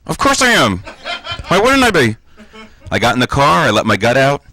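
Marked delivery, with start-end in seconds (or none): 0.1-2.2 high, tight defensive squeak; strained, gut sucked in. Acted. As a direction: answer high while holding your stomach in.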